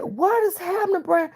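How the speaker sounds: high-pitched panicked question; retold panic, pitch way up; frantic. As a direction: demand answers in a high, alarmed voice. Frantic question to hysterical caller.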